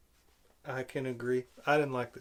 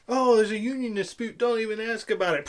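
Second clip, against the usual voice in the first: louder, higher mock hurried brush-off; pitched-up, raised-voice parody; mock Maz; 4 semitones higher, 5 dB louder than usual. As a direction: imitate someone calling out over commotion. Mimics Maz's busy call amid explosions.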